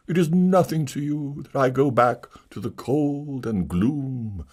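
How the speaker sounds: milk the loneliness; self-pitying tragedian. Acted.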